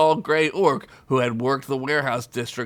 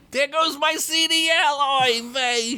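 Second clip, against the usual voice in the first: high, rueful goblin; mourning his license; woeful goblin voice; goblin lament. Acted.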